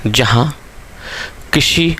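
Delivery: word-by-word dictation with long pauses. Real, not acted. Teacher dictating a definition to students, measured dictation. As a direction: dictate in small chunks, repeating for note-takers.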